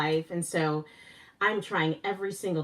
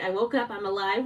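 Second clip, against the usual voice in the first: usual speaking voice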